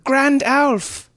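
high, slow, strained shout of a panicked youth; shouted youth's call. Acted. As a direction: call out breathlessly in a squeaky lad's voice.

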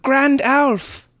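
high, loud drawn-out call; shouting. Acted. Youth running to the sorcerer, shouting. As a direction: shout his name as you run up. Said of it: "ran shouting".

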